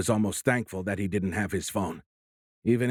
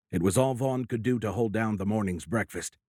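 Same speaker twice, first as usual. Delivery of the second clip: ordinary delivery, nothing marked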